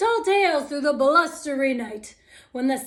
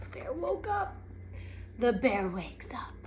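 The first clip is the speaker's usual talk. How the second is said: near-whispered narration; soft, secretive storytelling; hushed suspense; 5 semitones lower, 8 dB quieter than usual. A narrator's reading. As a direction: drop to a whisper, as if it might hear you. Hushed suspense as bear wakes.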